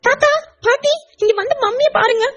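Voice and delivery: put-on very high, anxious child calling out; a small girl shocked at her mother's wound; child crying out to her grandparents; alarmed little girl. Child sees bandaged mother.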